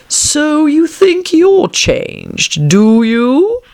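high, very drawn-out caterpillar voice; languid caterpillar. A narrator's reading. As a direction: stretch each word out in a lofty, challenging question. Caterpillar quizzes Alice in story.

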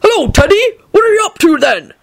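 high, lifted hailing call; hearty greeting. A narrator's reading. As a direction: greet him eagerly, pitched high. Hagrid hurries out calling a greeting.

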